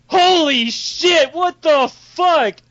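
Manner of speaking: high, slow, stunned exclamation; drawn-out shock. Acted. Ambushed squad seeing thirty people surround them, drawn-out shock. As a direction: stretch each word in disbelief.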